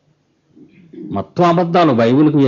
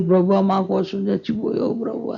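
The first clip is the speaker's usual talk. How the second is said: high, soft weepy wail; put-on sobbing plea, thinning away; mock tearful prayer; 5 semitones higher, 6 dB quieter than usual. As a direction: mimic a preacher crying to the Lord. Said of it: "ఆయన కోసం ఏడుస్తారు".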